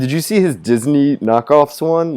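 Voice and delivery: normal talking voice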